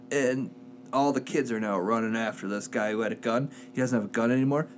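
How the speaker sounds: ordinary talk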